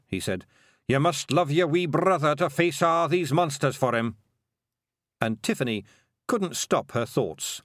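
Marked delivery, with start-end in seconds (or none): 0.9-4.2 higher, louder Scots brogue; Scottish wee man. A narrator's reading. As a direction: bold little Scottish warrior. William in Scots voice.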